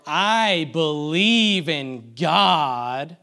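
slow, drawn-out spoken mock creed; sarcastic tone. Shown as a demonstration. Preacher asking if this sounds like worship, sarcastic. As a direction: stretch each word loudly with insincere weight. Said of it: "in this tone of voice".

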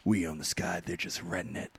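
very low, hushed actor imitation; mock-grim, dropped low and soft; Matthew McConaughey. Retelling the actor's great line about the sky, low and soft. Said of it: "Matthew McConaughey says, he's like".

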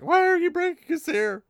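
put-on high, strained whine of a scared young man; panicky Rand. Rand balking at Moiraine's plan in a high panic. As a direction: squeal it out like a terrified farm boy. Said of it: "Rand's like".

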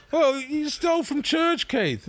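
high, slow, drawn-out reproach; mock outrage. Storyteller retells chiding Keith for robbing church, mock outrage. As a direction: scold a mate in comic indignation. Retold scolding about stealing.

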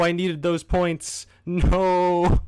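high, loud, slow anguish; anguished wail. Real, not acted. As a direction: wail over the loss. Despair after failing.

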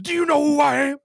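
high, loud intimidating demand; hammed-up sergeant's challenge, shouted; drill-sergeant shout. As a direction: bellow like an affronted drill sergeant. Acts out the sergeant's confrontation.

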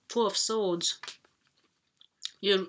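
normal talking voice

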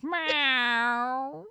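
imitating a full cat meow; a round cat meow. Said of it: "a beautiful, full meow".

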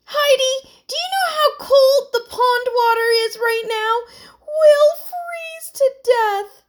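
put-on high, loud, exasperated girl; through clenched teeth. A narrator's reading. Emily to Heidi in the leaking boat, exasperated. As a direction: seethe and wail at a high pitch. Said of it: "said Emily through clenched teeth".

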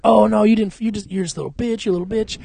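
high, jeering mob imitation; mock taunting. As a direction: pile on with mocking taunts. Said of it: "talking shit to him".

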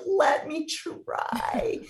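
very slow, high, stretched plea; desperate plea. Retold or imitated. Wife grabbing husband and begging, slow high plea. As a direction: beg with everything.

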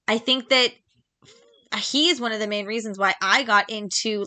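ordinary talk, nothing marked

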